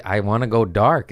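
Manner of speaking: ordinary talk, nothing marked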